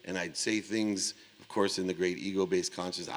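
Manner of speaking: usual speaking voice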